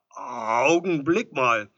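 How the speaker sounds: loud, drawn-out protest; loud interjection. A narrator's reading. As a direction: stretch the first word to halt someone. Edelstein stops the visitor.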